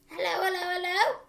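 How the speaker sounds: high, loud squawky parrot voice; parrot calling. Acted. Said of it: "came the voice again".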